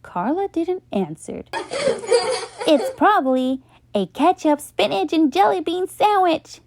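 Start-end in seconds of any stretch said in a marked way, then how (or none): 2.7-6.6 higher, mocking list; joking. A narrator's reading. Buster joking about Carla's sandwich, mocking. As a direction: poke fun. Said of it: "joked Buster".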